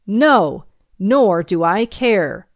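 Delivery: loud, defiant retort; slow, emphatic loud refusal; hurled back. Acted. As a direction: throw it back forcefully. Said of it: "I hurled back".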